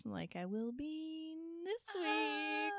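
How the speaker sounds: high, slow, rising squeal; real giddy excitement. Real, not acted. Friend anticipating this week's long drive, giddy excitement. Excited about trip days away.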